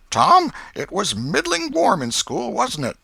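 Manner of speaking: aunt slyly questioning nephew Tom about school; Aunt Polly. A narrator's reading. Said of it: "Said she".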